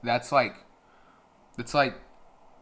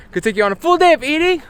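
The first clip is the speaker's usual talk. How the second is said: much higher and louder, fast; hyped; 15 dB louder, faster than usual. Real, not acted. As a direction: rush it out, buzzing with energy. Hyped announcement.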